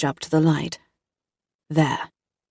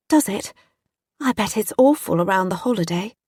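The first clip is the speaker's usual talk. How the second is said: slightly raised posh voice; posh, plummy accent; 3 semitones higher than usual. Acted. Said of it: "my accent posh and plummy".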